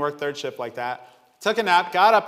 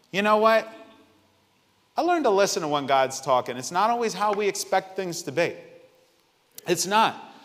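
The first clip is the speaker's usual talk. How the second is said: usual speaking voice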